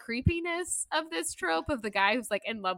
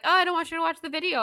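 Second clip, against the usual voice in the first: high, fast, louder mock protest; hammed-up hasty excuse; acted flustered denial; 9 semitones higher, 5 dB louder, faster than usual. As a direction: rush it out high to stop them. Acts out same denial.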